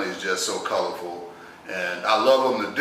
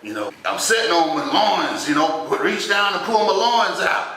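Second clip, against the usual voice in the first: insistent uncle; 8 semitones higher, 3 dB louder than usual. Retold or imitated.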